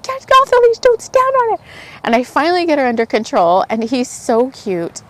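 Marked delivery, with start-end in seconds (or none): 0.0-1.6 high, raised reenacted yell; retold yell. Dog owner to older man on the leash, mock yelling. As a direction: shout a high, urgent mock warning. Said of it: "yelling at him".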